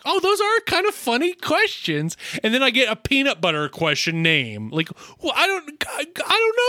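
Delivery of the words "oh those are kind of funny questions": mock cheerful. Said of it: "I'm like".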